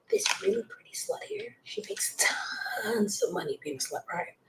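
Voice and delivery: high hushed whisper. Real, not acted. Whispering gossip about the girl with a child nearby. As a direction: breathe it out secretly. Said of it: "I'm whispering because there's a child".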